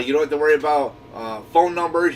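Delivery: normal talking voice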